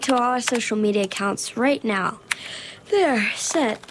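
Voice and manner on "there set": words through a yawn. Acted. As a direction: say it mid-yawn. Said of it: "why are you yawning?".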